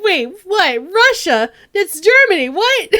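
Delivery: high, loud, mock-baffled squeal; mock confused viewer. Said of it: "everyone's like".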